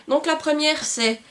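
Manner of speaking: ordinary talk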